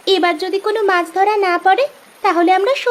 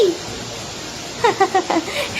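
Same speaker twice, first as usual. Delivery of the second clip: a soft delighted laugh; joyful laugh; 7 dB quieter than usual. Acted. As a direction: laugh at the sudden luck. Sisters delighted by fish rain.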